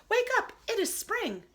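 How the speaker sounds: high, eager Frog voice; bright call to a sleepy friend; Frog's voice. Acted. Frog urging Toad awake, high eager call. Frog calling through door.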